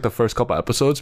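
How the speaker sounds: ordinary talk, nothing marked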